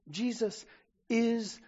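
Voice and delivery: usual speaking voice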